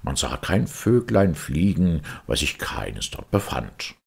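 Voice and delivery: ordinary delivery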